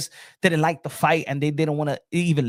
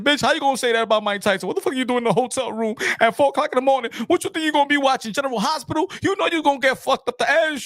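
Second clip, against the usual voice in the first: mocking angry boxing fans; 8 semitones higher than usual. Boxing fans yelling at the accuser in a high, fast jeer. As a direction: sneer at her like a mob of ringside fans. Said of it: "we started bitching at Desiree Washington".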